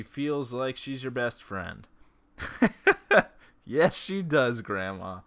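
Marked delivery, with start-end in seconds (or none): 2.4-3.3 loud laugh. Real, not acted. Reacting to Gran joke.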